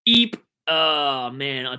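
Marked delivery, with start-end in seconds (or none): 0.1-0.4 high, loud startled squeak; alarmed eep. Real, not acted. Streamer realising his viewers may be underage, alarmed. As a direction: let out a sudden high "eep".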